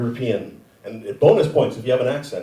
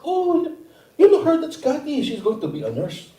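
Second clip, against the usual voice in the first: high-pitched mom impression; mom's voice. Comic telling his mom's reply about cousin Kathy, high mock-mom voice. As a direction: act out a mother in a raised voice. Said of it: "You go to my mom".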